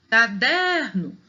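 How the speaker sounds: CADERNO slowly, DER lifted and held; calling the word. Shown as a demonstration. Teacher showing penultimate stress, calling caDEERno. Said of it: "o der é mais forte".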